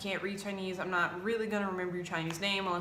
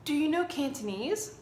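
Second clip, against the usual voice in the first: a higher, self-assured student voice; all confident; 7 semitones higher than usual. Retold or imitated. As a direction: ask it like you're sure of yourself. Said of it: "like, all confident".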